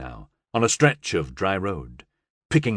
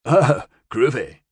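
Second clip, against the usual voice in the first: laid-back hippie; 3 semitones higher, 3 dB louder than usual. A narrator's reading. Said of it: "Cochrane said".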